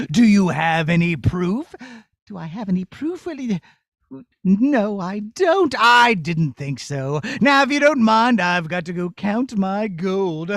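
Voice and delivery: low smug villain voice; gloating wizard. Acted. Evil wizard gloating to the princess. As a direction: relish being the bad guy.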